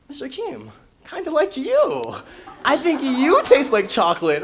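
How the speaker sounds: put-on high, slow little-kid voice; affectionate child. Kindergartner confessing fondness to teacher, high and drawn out.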